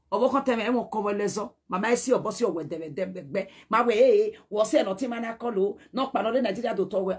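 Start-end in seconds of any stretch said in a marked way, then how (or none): none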